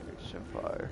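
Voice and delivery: whispered obsessive yandere voice; overacted anime dub. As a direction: breathe it like a creepy stalker. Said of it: "like, yondeer".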